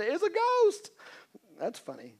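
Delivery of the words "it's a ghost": high, scared yelp of a frightened disciple; terrified cry. Acted. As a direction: squeal it out as if you saw a ghost. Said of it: "they were terrified and said".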